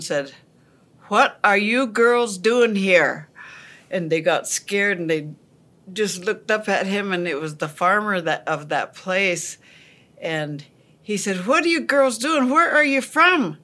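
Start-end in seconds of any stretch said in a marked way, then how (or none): 1.1-3.3 farmer calling out. Retold or imitated. Farmer finds girls hiding in corn. 11.5-13.6 farmer calling out. Retold or imitated. Farmer questions the hiding girls.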